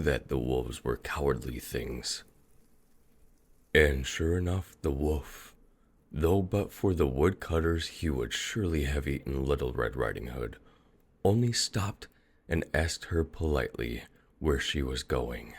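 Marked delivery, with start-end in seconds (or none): none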